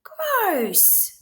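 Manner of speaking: high, disgusted shout; disgusted cry. A narrator's reading. Pigs cry out after the wet sneeze, disgusted. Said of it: "they all cried".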